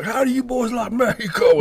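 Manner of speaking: high, loud unhinged trooper; crazy trooper voice. Retold or imitated. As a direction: squeal it like a crazed cop. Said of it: "He's like".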